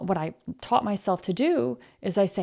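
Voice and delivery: usual speaking voice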